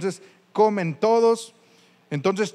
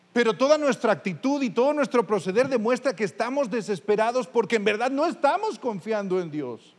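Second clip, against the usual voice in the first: usual speaking voice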